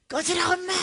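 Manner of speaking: high, loud dismissal; imitated drunk yell. Drunk man shooing away polite dad, shouting. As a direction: explode at him like an angry drunk. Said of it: "이런데".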